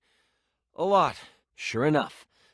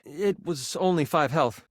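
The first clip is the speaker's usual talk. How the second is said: normal talking voice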